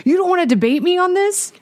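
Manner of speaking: high-pitched mock challenge; mock indignant challenge. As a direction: imitate an indignant man demanding a debate. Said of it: "legit starts to yell at me".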